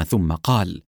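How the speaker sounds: ordinary delivery, nothing marked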